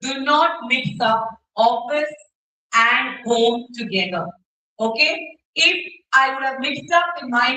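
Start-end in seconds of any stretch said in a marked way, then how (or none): 0.0-4.2 slow, each word set down firmly; a teacher stating the rule word by word; slow emphatic mantra. Shown as a demonstration. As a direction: drive the rule home slowly. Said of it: "Remember this mantra".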